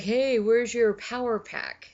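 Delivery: high, loud, drawn-out question; imitated coworkers. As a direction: call out like teasing coworkers. Voicing coworkers asking about pack.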